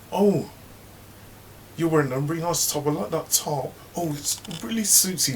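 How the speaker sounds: high gushing fake praise; fake-sweet gossip. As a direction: compliment her top insincerely, high. Mother hen fake compliment.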